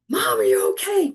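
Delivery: high, urgent mock alarm; alarmed son. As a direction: imitate a son calling out in alarm. Retold alarmed son rushing over.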